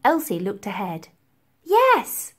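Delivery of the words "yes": put-on higher, eager girl's yes; excited girl. A narrator's reading. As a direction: call back eagerly.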